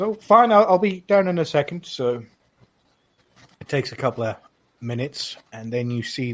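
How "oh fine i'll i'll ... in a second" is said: lightly voiced high elf, a bit higher; Nenya's voice. GM as Nenya answering the guard, lightly pitched up. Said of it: "she goes".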